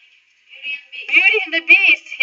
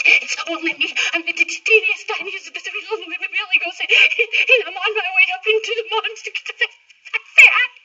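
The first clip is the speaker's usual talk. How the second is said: tiny scared goat; 6 semitones higher than usual. Acted. Smallest goat pleads with troll.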